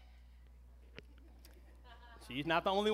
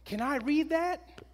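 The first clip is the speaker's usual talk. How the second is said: put-on much higher, slow eager child; higher, slow hopeful little-girl voice; daughter; 10 semitones higher, much slower than usual. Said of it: "And she said".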